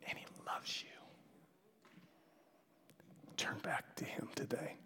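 very soft, near-whispered appeal; hushed, tender. Real, not acted. Preacher urging the congregation back to God, hushed and tender. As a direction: drop right down and plead gently.

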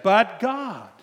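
loud, high protest; arguing with God. Retold or imitated. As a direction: cry out the objection. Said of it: "And I said".

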